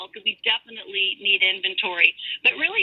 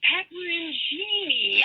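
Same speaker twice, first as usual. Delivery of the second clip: retold word drawn out slowly, pitched higher; slow repeat; 7 semitones higher, much slower than usual. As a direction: imitate someone repeating it slowly for a confused listener.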